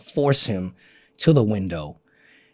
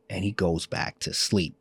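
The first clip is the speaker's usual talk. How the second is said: normal talking voice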